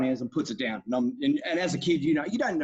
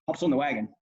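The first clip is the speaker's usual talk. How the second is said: usual speaking voice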